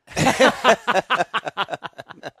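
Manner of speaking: big open burst of laughter; hearty laugh. Real, not acted. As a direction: laugh out loud at the punchline. Laughs at own joke about fear.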